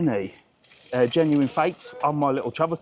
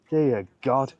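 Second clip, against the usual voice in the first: ordinary talk, nothing marked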